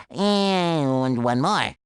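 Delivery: slow, low, stretched-out words; long, low, laboured stretch; drawn-out last item. Acted. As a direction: drag it out as the list's final straw. Drags out end of repeated list.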